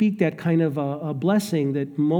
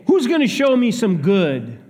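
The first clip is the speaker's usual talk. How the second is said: louder, higher, pushy demand; demanding challenge; 5 semitones higher, 5 dB louder than usual. Retold or imitated. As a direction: throw it out as a challenge, not a question. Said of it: "many who say".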